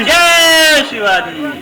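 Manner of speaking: slow distant crowd answer; crowd reply. Real, not acted. (भीड़ का जवाब)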